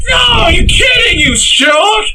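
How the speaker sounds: high, loud, slow indignant yell; mock outraged shout. Ranting at the love interest, high outraged yell.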